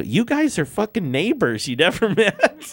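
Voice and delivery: gleeful reveal with laughter; excited exclamation. Retold or imitated. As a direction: announce it as a wild surprise. Excited introduction of two musicians.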